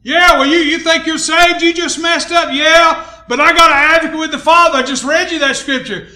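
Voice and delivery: high, loud, forceful rebuttal; fired-up preaching. Real, not acted. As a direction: shout it from the pulpit.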